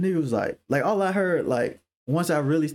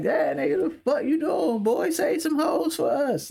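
rising into a high-pitched yelp; mock-indignant compliment; 12 semitones higher than usual. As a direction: praise him as if offended by him. Demonstrates backhanded compliment among men.

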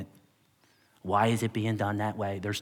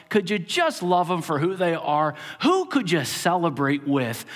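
higher, louder preaching challenge; 5 semitones higher, 4 dB louder than usual. Acted. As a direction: drive each question home to the church. Closing challenge, rising.

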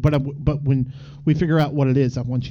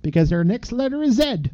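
higher, lilting Canadian sign-off; Canadian accent; 6 semitones higher than usual. Acted. Telling the co-host to say goodnight, Canadian accent. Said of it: "Yeah, you hoser".

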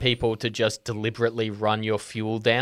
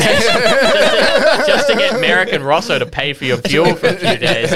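laughing; 8 semitones higher, 7 dB louder than usual. Real, not acted. Laughing through the explanation.

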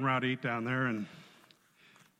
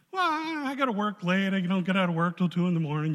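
loud, higher imitation of excuses; reluctant excuse-maker; 5 semitones higher, 7 dB louder than usual. Invited guy explains he works late, loud reluctant excuse. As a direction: draw out a high "well", then protest loudly. Acts the acquaintance making excuses.

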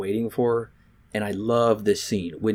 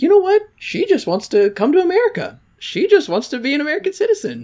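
much higher mock voice, mocking; sarcastic high voice; 4 dB louder than usual. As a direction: squeal the conclusion sarcastically. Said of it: "So I'm like".

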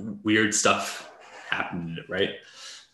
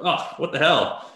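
disgusted surprise; 7 semitones higher than usual. Retold or imitated. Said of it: "And you're like".

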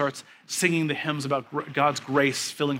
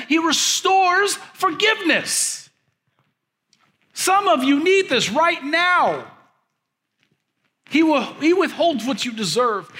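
rising, louder preacher's cadence; preaching; 8 semitones higher, 4 dB louder than usual. Acted. Pastor preaching to his congregation, building fervor.

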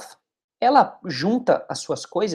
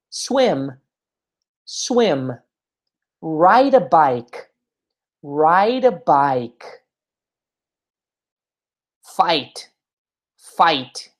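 slow model; much slower than usual. Shown as a demonstration. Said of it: "slow model for repeating".

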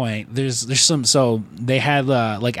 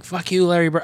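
mock yell, pitched up; higher-voiced imitation of a heckling fan; imitated screaming; 7 semitones higher than usual. Barfly naming players to curse, mock screaming. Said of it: "screaming out".

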